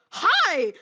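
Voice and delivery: loud, high, wildly excited greeting; a sudden shouted hi, full of wild energy; manic hi. Retold or imitated. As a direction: burst out with chaotic glee at a stranger. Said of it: "matching energy goes".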